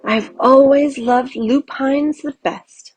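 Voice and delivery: slow, contented savouring; satisfied. A narrator's reading. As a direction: relish the name of your favourite flower. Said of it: "with satisfaction".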